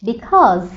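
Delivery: because ending in a voiced z; z sound in because. Shown as a demonstration. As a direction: land the z in because. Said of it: "এস এর সাউন্ডটা হবে".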